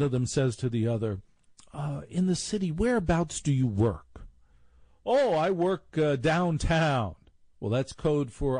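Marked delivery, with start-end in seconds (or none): 1.7-4.3 snooty preppy voice. Retold or imitated. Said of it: "talking like they're sixty-five". 5.1-7.1 higher, slow, snooty preppy drawl; posh preppy drawl. Retold or imitated. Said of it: "talking like they're sixty-five".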